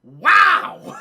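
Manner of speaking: huge, high-pitched "wow"; amazed shout. Real, not acted. Streamer blown away by a movie plot, amazed shout. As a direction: belt it out in disbelief.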